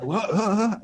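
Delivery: startled. Retold or imitated. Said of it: "starting to freak out, half asleep".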